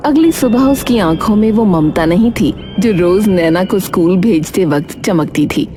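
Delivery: usual speaking voice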